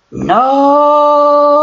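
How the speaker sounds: high, loud scream of no; shout. Acted. Pinky screaming as Rainbow Dash attacks her friend, loud shout. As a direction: cry it out at full force. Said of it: "Pinky would shout".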